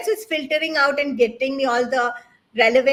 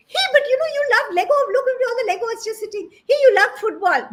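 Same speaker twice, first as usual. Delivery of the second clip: high, coaxing mom voice, pitched up; mother's pleading voice; 10 semitones higher than usual. Retold or imitated. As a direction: coax your kid like a mom. Mother pleading with son in story.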